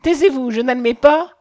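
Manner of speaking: upset, forceful refusal; indignant protest. A narrator's reading. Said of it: "Elle rougissait de honte et d'indignation".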